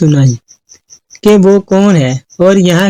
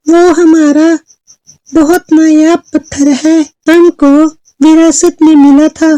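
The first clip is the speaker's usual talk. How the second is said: high, measured fairy voice; storytelling fairy; 7 semitones higher than usual. Acted. Fairy tells girl of queen and witches, high storytelling voice. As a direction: recount old history in a raised voice. Fairy explains the magic stone.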